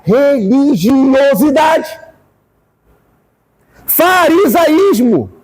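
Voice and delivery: drawn out, preaching shout; slow shouted denunciation. Real, not acted. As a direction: hammer each word slowly.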